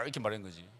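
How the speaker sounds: ordinary talk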